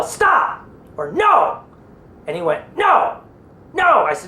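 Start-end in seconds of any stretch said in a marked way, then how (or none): 0.0-1.6 high-pitched yell at moderate volume; demonstrated yell. Shown as a demonstration. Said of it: "I want you to yell". 2.8-4.0 high-pitched mock yell; retold yell. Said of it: "I want you to yell".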